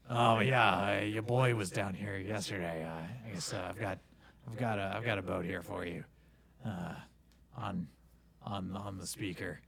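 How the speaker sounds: lightly voiced casual boatman; a hint of a rambling dockhand; dock worker. GM voices boat renter.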